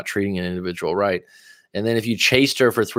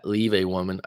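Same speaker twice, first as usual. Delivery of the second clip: usual speaking voice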